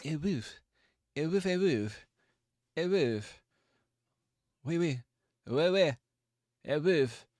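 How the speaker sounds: high-pitched French dog woofing; French-accented bark. Acted. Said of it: "Does he bark with an accent?".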